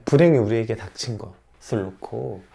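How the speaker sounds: normal talking voice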